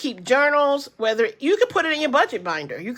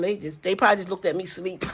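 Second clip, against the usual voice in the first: ordinary talk, nothing marked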